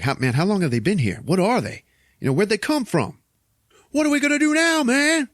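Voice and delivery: high, frantic questions building to a yell; acted panic. Keith David unable to believe the aliens, hammed-up rising panic. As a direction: let the mock panic climb with each question.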